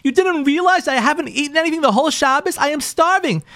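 high-pitched, indignant retold wife; imitated wounded outrage, pitch climbing; resentful. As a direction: act out a wife accusing her husband in disbelief. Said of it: "she's, like, resentful".

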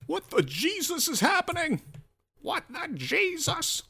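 squeaky high falsetto fussing; mock outrage. Cartoonish fuss over failed loading.

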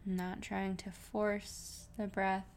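soft, even meditation voice with long pauses; guided meditation. Real, not acted. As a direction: gently guide listeners into relaxed breathing, unhurried.

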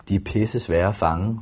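ordinary delivery

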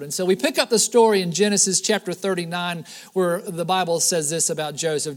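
ordinary delivery

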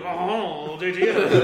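a hint of bad French accent; mock French accent, laughing. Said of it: "terrible French accent".

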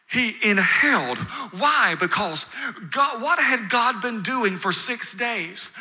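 ordinary talk

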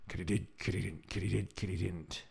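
soft chirping bug rhythm; quiet insect-call mimicry; katydid chirp imitation. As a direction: chant it like insects in the trees.

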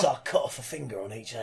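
put-on gruff old man, pitched low; Longshot. Old actor explaining a lost role, low gruff voice. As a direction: grumble it low like an old-timer. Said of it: "Really gruff old long shot".

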